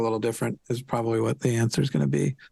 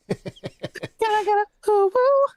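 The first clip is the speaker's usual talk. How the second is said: cutesy uwu. Pretend. Co-host performing an uwu for a donor, cutesy squeak. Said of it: "see if I can do a".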